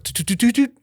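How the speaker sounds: high-pitched rapid "toot" noises; imitated door sounds. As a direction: mimic car doors popping open in quick succession. Imitates car doors popping open.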